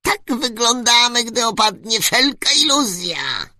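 very high, loud squeak through a megaphone; squeaky little voice. Acted. Tiny ruler greets the travellers through a megaphone, very high squeaky voice. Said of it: "odparł głos przez megafon".

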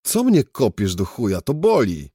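high, loud protest of pain; loud complaint. Acted. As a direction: complain sharply, as if just kicked. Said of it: "Poskarżyłem się". (głośna, podniesiona skarga)

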